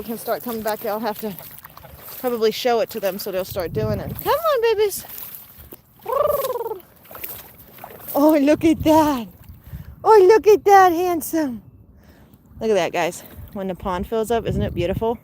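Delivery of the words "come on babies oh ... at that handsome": high delighted calling; excited. Real, not acted. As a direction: gush over the geese.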